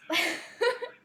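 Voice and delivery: real high, loud burst of laughter. Real, not acted. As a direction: burst out laughing at your own muddle. Laughs at her own mix-up.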